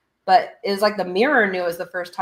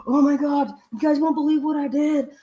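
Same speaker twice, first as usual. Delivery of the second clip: higher-pitched, breathless rush of news; excited blurting; 7 semitones higher than usual. Retold or imitated. Late friend breathlessly telling his friends about his big news. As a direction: burst in bursting to tell.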